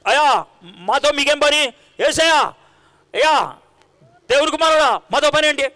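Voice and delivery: a tormented man begging Jesus, rising to a shout; possessed man shouting at Jesus from the tombs, pleading; possessed man crying out. Acted.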